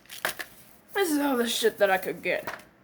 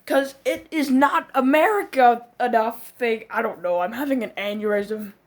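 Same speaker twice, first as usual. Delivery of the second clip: normal talking voice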